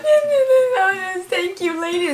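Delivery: high, loud sobbing "eh, eh" breaking into thanks; crying voice, words breaking. Retold or imitated. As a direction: blubber a teary thank-you. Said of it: "it sounded like I was being rude".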